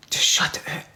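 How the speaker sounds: unvoiced, intimate whisper. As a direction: breathe the advice softly. Whispered emphatic advice.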